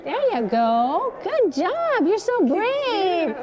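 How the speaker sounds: high, slow, sing-song praise; lavish the dog with slow, high praise. Real, not acted. Trainer praising a scared dog, slow and high.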